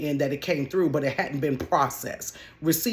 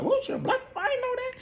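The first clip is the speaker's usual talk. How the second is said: much higher, squeaky mock surprise; surprised caller. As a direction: play a caller caught off guard, voice jumping up high.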